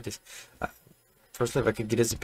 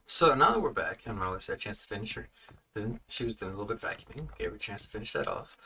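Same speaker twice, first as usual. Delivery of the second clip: ordinary delivery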